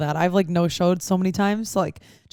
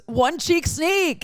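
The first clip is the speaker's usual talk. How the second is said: high, drawn-out tipsy squeal; tipsy girl; 9 semitones higher, much slower than usual. Retold or imitated. Tipsy woman greeting a near stranger with an odd line, imitated high and giddy. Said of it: "like, a little tipsy girl".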